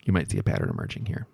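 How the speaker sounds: hushed, fast whisper to the listeners; whispered aside. Real, not acted. Narrator whispers a wry aside to the audience, hushed. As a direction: lean in and whisper it quickly.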